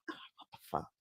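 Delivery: breathless whispered disbelief; shocked whisper. Real, not acted. Hearing the sister has a different daddy, whispered shock. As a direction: whisper it, stunned by the news.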